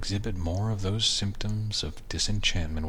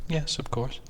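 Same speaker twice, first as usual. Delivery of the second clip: wife voice; 7 semitones higher than usual. Acted.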